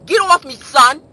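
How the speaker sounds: loud, high New York shout; New York accent. Acted. Mimicking New Yorkers who say son, loud New York accent. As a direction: bark it with a New York twang. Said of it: "Y'all say son a lot".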